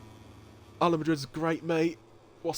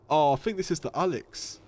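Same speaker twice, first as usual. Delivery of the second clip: ordinary talk, nothing marked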